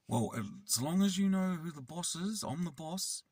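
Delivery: high-pitched imitation of a self-important kid; bossy child. Six-year-old telling carer who's boss, high and cocky. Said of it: "then he said".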